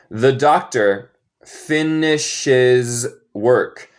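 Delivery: slow, careful teacher demo; slow model sentence. Shown as a demonstration. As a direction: spell it out slowly for learners. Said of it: "teacher models slow sentence".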